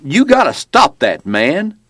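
high, drawn-out holler; mild yell, slow and high; yelled protest. A narrator's reading. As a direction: call it out from the truck, pitched high. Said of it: "The driver yelled".

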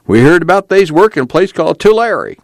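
high, whining plea; servile whine. A narrator's reading. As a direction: whine it humbly to the armed men. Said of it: "voice took on a servile whine".

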